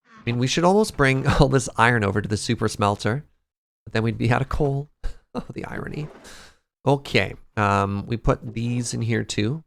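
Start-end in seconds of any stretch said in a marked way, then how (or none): 5.3-6.1 whispering. Real, not acted. Joke on iron.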